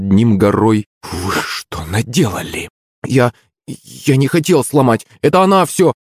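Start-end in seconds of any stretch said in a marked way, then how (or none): 1.0-2.7 slow, hushed outrage; menacing reproach. A narrator's reading. Cleaning lady looming over the boys, reproachful. 3.1-5.9 stammering start, rising high; stammer, then shift the blame in a higher voice; panicky excuse. A narrator's reading. Boy caught with the broken mop, flustered excuse.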